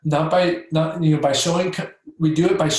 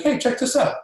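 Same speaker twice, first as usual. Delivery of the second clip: light, offhand and higher, no big deal; minimizing tone; 8 semitones higher than usual. Shown as a demonstration. Doctor showing teen Paul the spine, offhand and casual. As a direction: play it down like it's nothing. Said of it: "minimizing tone, like, it's not a big deal".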